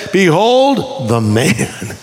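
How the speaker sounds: slow, grand "Behold the man"; drawn-out, theatrical declaration; proclamation as Pilate. Acted. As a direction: announce it with long, dramatic spacing. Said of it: "Even Pilate said".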